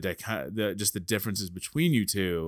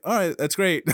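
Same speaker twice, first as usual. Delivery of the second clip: steadying imitation; 8 semitones higher, 5 dB louder than usual. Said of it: "he's the guy that's like".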